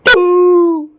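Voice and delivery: very high, loud drawn-out "two"; insistent correction. Retold or imitated. Repeating his correction to the speaker, high insistent hoot. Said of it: "He corrected me. He said".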